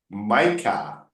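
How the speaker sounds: mica said slowly, MY-kah; teacher modelling mica slowly; slow model word. Shown as a demonstration. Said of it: "how to pronounce it".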